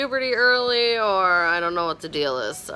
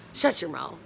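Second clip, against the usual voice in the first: ordinary talk, nothing marked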